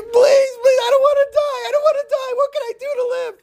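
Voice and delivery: very high, crying mock plea; panicked begging. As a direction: squeal and beg for mercy. Frightened man begs for his life.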